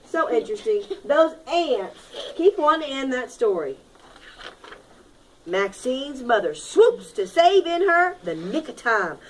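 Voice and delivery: normal talking voice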